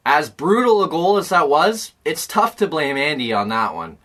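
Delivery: ordinary talk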